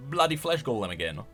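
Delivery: ordinary delivery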